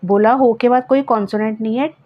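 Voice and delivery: normal talking voice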